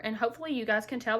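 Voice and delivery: ordinary talk, nothing marked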